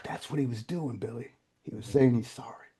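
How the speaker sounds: real emotion, voice dropping very soft; emotional. Real, not acted. Friend realizing [name] was apologizing, quietly emotional. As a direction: let the voice sink away as it hits you. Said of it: "I'm sorry, this gets emotional".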